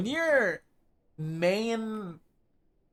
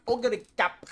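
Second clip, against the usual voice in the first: mock announcer "Ogre cap", pitched up with a punched "cap"; strongly emphasized imitation; 7 semitones higher than usual. Showing how anime says "Ogre cap", exaggerated stress on "cap". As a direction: imitate a commentator hitting "cap" hard and high in "Ogre cap". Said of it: "they, like, say it strongly".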